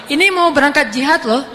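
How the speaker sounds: usual speaking voice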